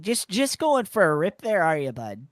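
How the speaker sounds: hammed-up Canadian, "bud" and "rip around town"; a mock-Canadian teasing a friend; exaggerated Canadian accent. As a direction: push the Canadian accent on purpose. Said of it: "That's the phrase that I was messing up with".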